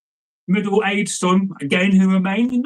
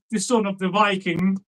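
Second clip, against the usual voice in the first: ordinary delivery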